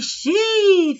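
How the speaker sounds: XI drawn out high; stressed long syllable. Shown as a demonstration. Teacher showing why it is tônico, XI held long. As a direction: stretch xi long, as the strong syllable. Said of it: "ficou maior".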